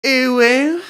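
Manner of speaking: high, squeaky cutesy uwu. Pretend. Podcast host doing a dare on camera, high cutesy uwu. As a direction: coo it like an anime catgirl, high. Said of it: "I'm gonna do an uwu on camera".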